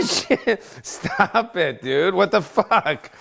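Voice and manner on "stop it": usual speaking voice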